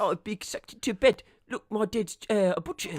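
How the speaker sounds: mock Depp voice. Depp in Nightmare on Elm Street.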